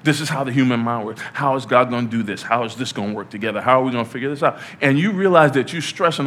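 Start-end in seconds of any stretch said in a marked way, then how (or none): none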